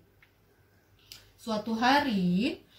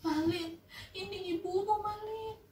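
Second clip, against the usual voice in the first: high, softer, pleading; pleading mother; 10 semitones higher, 5 dB quieter than usual. Acted. Mother pleading with her son, high and soft. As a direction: beg him to recognize you. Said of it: "katanya gitu".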